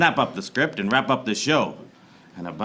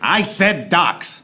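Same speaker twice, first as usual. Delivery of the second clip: docks said slow and loud, higher; annoyed correction; 7 semitones higher, 6 dB louder, much slower than usual. Acted. Detective correcting the duck sound, slow and loud. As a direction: correct the sound guy sternly.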